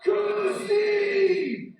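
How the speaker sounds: mimicking the roaring crowd; crowd shout. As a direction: bellow it like fans at the finish. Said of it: "they're shouting".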